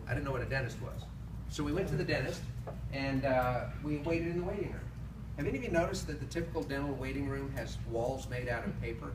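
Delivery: normal talking voice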